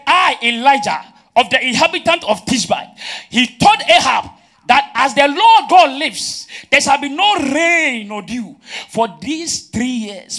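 loud, ringing declamation; forceful preaching. Acted. Preacher quotes Elijah's decree to Ahab, forceful declamation. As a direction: proclaim it like a prophet's decree.

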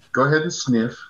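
ordinary talk, nothing marked

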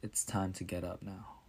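soft whisper at the bedside. A narrator's reading. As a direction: whisper gently to wake the child. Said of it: "he whispered".

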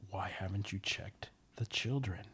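slow, understated sinister caller; creepy caller. Acted. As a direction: draw the question out with quiet menace. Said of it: "And he says".

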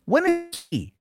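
choppy garbled stream audio; connection breaking up. Real, not acted. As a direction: voice cutting out over the stream. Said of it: "You're breaking up".

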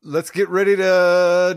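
slow, loud ring announcer build. Pretend. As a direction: belt it like a boxing announcer. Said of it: "I'm not gonna continue that".

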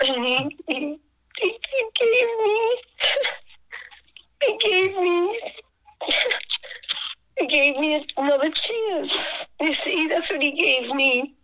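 fighting back tears while telling it; choked up. Real, not acted. Telling the interviewer about [name], voice breaking. Emotional memory of [name]'s gift.

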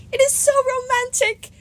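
very high swooning goddess; Aphrodite. A narrator's reading.

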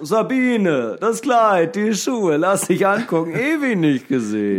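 high, gushing socialite; gushing high greeting. Retold or imitated. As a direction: greet her like a long-lost friend. Acted gushing greeting to neighbour.

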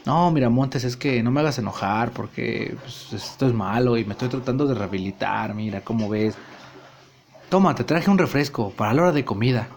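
low, soft repentant murmur; meek apology. Acted. Nacho apologizes to Montes with a soda, low and soft. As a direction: hush it down like a reformed man.

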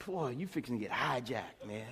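low, quiet, unimpressed warning; a dad's deadpan put-down to a cocky kid; stern dad to teen. Retold or imitated. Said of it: "you just look at them and go".

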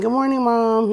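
put-on high bright child greeting; sing-song good morning like a kid; cheerful kid greeting. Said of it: "my son comes and".

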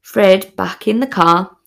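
ordinary talk

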